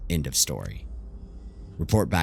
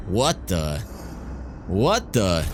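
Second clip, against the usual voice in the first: higher, louder burst of surprise; startled disbelief; 4 semitones higher, 3 dB louder, much slower than usual. Acted. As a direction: blurt it out, caught off guard. Startled by an attack mid-action.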